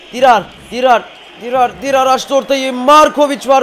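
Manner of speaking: loud, high, urgent play-by-play; excited calling. Real, not acted. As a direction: keep calling the runner's name as the attack builds. Live attack building.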